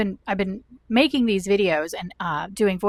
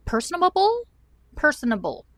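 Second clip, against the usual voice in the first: squeaky sing-song; 8 semitones higher than usual. Real, not acted. Vlogger riffing on one word, squeaky sing-song. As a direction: pitch leaps way up on personable.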